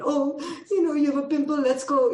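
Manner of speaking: mock fussing parent. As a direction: coddle the child over a pimple, voice up. Mocking American indulgent parent.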